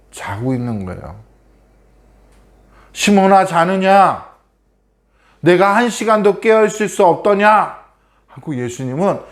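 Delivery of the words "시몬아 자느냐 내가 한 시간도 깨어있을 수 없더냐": reproachful Jesus. Acted. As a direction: wake them with sorrowful reproach. Jesus rebukes sleeping disciples. (잠든 제자들을 꾸짖는 예수님, 높고 큰 책망)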